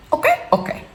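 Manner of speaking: put-on high little-girl voice copying her daughter; toddler's okay. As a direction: chirp it like a two-year-old. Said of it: "Her favorite thing to say now is".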